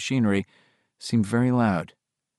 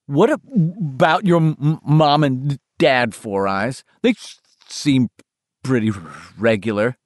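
stuttering; 2 semitones higher than usual. Acted. Bill's stuttering voice.

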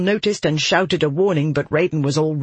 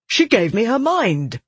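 higher, indignant accusation; accusing; 7 semitones higher than usual. A narrator's reading. Soldier pointing at the girl, accusing. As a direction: accuse her in disbelief. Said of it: "stabbing a finger at Marrow".

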